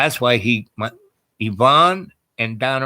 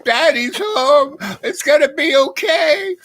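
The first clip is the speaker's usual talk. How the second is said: much higher, slow, sing-song dog voice; dog's voice; 4 dB louder, slower than usual. Retold or imitated. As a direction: voice the happy dog, high and drawn out. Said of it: "Bella's just rolling on her back, going".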